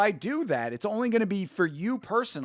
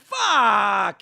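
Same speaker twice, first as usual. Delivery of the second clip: loud, drawn-out angry shout; real frustrated shout; shouted curse; 5 semitones higher, 7 dB louder than usual. Real, not acted. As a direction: yell it at the screen, furious. Parlay dies, he yells.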